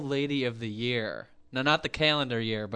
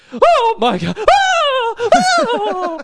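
shrill drawn-out scream; old lady screaming; 12 dB louder, much slower than usual. Retold or imitated. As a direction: scream like you're under attack. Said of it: "they scream like the escalator's".

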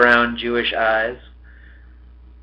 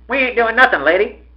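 put-on high-pitched innocent boy; wheedling kid's protest; ingratiating; 11 semitones higher, 4 dB louder than usual. A narrator's reading. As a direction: sweet-talk her with a smile. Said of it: "ingratiating smile which always won over his mother".

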